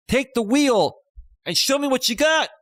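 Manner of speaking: loud, high-pitched imitated urging; mock urgent plea. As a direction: act out urging someone to take over. Voicing her urgent plea to Jamie.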